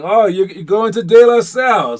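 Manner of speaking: high, loud burst of surprise; surprised. Real, not acted. Reacting to the viewer going to De La Salle, loud surprised. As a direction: caught off guard by the news, voice jumps up.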